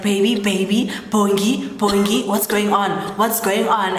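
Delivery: loud, urgent retold alarm; mock panic. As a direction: call out to a collapsing partner, slightly panicked. Retells husband's panic as she chokes.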